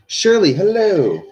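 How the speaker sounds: high, loud, drawn-out hello; calling out. Real, not acted. Streamer breaks off to greet Shirley, calling out.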